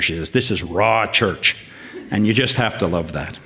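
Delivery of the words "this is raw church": slow, drawn-out hint of an Ottawa Valley accent; imitating friend's Ottawa Valley accent. Quoting a friend from Pembroke, slow Ottawa Valley accent. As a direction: lightly mimic Della's Ottawa Valley lilt, slowly. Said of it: "she has this lovely Valley accent".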